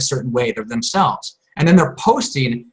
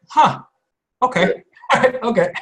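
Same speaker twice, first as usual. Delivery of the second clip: stunned huh; 7 semitones higher than usual. Retold or imitated. Reacting to a blunt statement.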